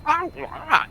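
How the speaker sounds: high-pitched creature yammering; kobold noises. Acted. Kobold approaching the captain, high wordless creature noises. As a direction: squawk wordlessly like a little kobold.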